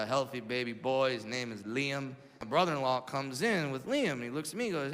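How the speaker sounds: ordinary talk, nothing marked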